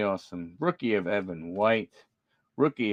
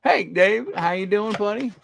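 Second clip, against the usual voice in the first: higher, cheery buddy greeting; playful greeting; 8 semitones higher, 4 dB louder than usual. Pretend. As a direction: greet the card like a pal. Greeting a card like a friend.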